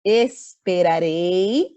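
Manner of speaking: slow, loud, stretched out; drawn-out model word. Shown as a demonstration. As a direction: stretch the verb so students hear it. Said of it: "como é que fica esse verbo na mesóclise".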